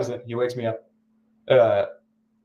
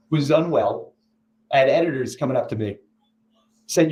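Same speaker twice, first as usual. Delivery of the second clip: ordinary talk, nothing marked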